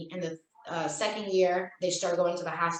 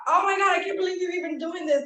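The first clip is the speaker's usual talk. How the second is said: put-on much higher, fast, embarrassed teen outburst; mortified teen daughter; 9 semitones higher, faster than usual. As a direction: play the daughter, horrified at her dad. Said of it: "That, that was her".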